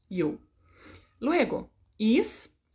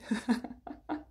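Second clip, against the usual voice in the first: short laugh; 6 dB quieter than usual. Real, not acted. Laughs at her own singing.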